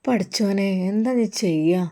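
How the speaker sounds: a touch of tears, voice sinking quietly; crying. Acted. Daughter-in-law on the phone to her mother, softly tearful. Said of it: "അവൾ കരഞ്ഞ് പറഞ്ഞു".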